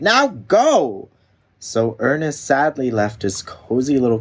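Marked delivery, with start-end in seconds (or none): 0.0-1.1 high, loud sharp order; firm command. Acted. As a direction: send him off sharply. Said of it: "she replied".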